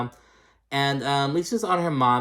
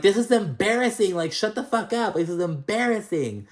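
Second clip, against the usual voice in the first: Lisa yelling; 10 semitones higher than usual. Retold or imitated. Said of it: "Just yell".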